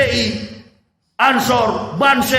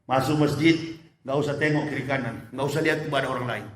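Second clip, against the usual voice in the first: usual speaking voice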